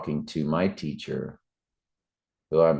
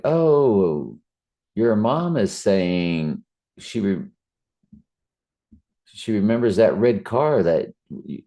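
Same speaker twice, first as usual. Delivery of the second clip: higher-pitched, drawn-out mock medium; lightly put-on channeler voice, pitched up; imitated spirit medium; 5 semitones higher, 4 dB louder than usual. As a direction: play a medium relaying a message. Acting out a medium relaying messages.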